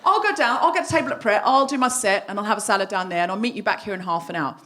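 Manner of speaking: ordinary talk, nothing marked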